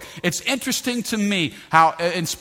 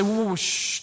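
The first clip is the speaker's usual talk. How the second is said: acted hushing; 4 semitones higher than usual. Acts out hushing the noise.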